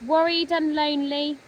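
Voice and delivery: ordinary delivery, nothing marked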